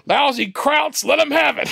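high, hammed-up gangster battle cry; imitated yell. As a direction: holler it like a movie tough guy opening fire.

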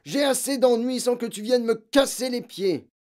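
loud, high-pitched irritated rant; exasperated father yelling; angry scolding. A narrator's reading. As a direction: bark at the child for pestering you. Father keeps scolding after barking.

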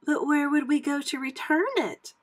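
put-on high, questioning young pup; puppy Colette. A narrator's reading. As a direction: ask in a high little puppy voice. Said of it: "Colette asked".

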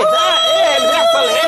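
loud, high outraged boss; angry shout. Acted. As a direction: yell at the chaos in the office. المدير يزعق على الفوضى. (المدير يزعق بغضب على الفوضى في المكتب)

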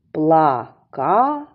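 "Плакати" in three slow, separate beats, Пла-ка-ти; syllable by syllable. Shown as a demonstration. Teacher dividing this word for children, slow separated syllables. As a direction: break "Плакати" slowly into PLA, KA, TY. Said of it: "Давайте поділимо це слово".

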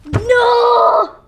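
angry outcry. Acted. Villain cries out, retake.

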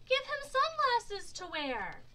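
put-on high, loud child's call; storybook kid shouting a suggestion. A narrator's reading. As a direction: pipe up loudly over a crowd.

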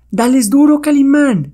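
high, loud shout of encouragement; excited cheering. Acted. Companion cheers Calimán on in the fight, excited. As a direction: cheer on your champion.

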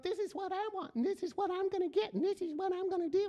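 high, fast mocking me-me-me voice; mock a selfish partner in a silly high voice; self-centred whiny voice. Preacher mocking a selfish spouse in a silly high voice. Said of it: "I don't know why I use that voice".

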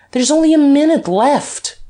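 high, loud warning; urgent call. A narrator's reading. Duke counting down for Guerchard, high loud warning. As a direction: ring it out as an alarm.

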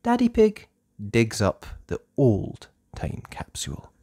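ordinary delivery

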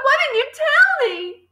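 high, slow, wailing reproach; girl's drawn-out high wail; hurt cry. A narrator's reading. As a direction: plead with your teacher, upset. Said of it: "Matilda cried".

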